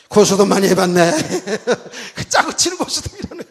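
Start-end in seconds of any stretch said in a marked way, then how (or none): 1.1-3.4 high, laughing through the words. Real, not acted. Preacher laughing at the groom's card-game joke, higher. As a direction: chuckle while you say it.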